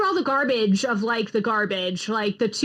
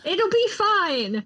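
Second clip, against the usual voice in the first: mock reassurance; 9 semitones higher, much slower than usual.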